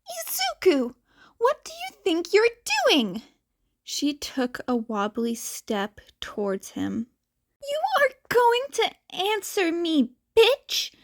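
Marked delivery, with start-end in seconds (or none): 0.1-3.2 high, harsh mother voice, menacing; a drunk mother looming over her son; high sneering threat; voiced drunk mother. 7.6-10.9 high, snarling mother voice; high furious drunk mother; angry mother. Acted. Drunk mother yelling at son.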